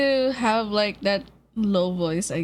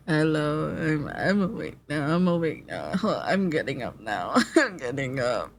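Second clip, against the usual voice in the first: deep groggy just-woke-up voice; low and sleepy, barely awake; morning voice; 7 semitones lower than usual. Retold or imitated. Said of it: "that's my voice when I wake up".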